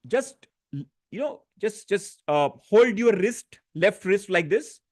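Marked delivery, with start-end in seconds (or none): none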